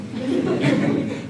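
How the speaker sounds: short quiet laugh at his own joke; a brief hushed laugh; soft laugh. Real, not acted. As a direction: chuckle it off softly. Jokes Lamentations depress him.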